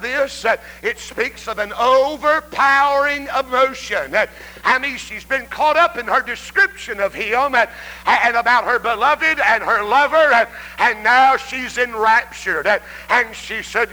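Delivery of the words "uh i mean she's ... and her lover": usual speaking voice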